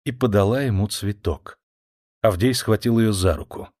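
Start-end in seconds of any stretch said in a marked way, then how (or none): none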